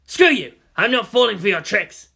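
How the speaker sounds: loud defiant shout; scared and shouting; robber's yell. A narrator's reading. Robber panics at countdown.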